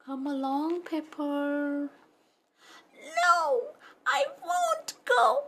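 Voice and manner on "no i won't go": high, whimpering puppy pleading; a scared little pup begging to stay home; tearful puppy. Acted. Puppy Pepper begs Mama not to send him to school, high and tearful.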